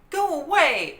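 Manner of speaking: high, loud angry shout. A narrator's reading. Annie shouts the monkey away. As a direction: chase the monkey off. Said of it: "Annie shouted".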